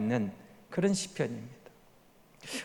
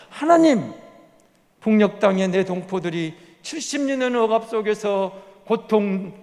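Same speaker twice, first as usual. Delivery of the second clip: impassioned prayer; 2 semitones higher, 4 dB louder than usual. Acted. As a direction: pray out loud for the suffering, pleading hard. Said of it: "이런 기도가 나와요".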